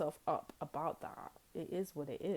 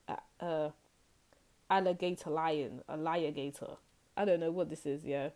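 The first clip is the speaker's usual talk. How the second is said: ordinary talk, nothing marked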